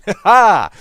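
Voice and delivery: loud, high burst of laughter; loud cackle. Real, not acted. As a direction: cackle at your own joke. Loud cackle at own joke.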